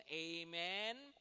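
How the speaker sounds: ordinary delivery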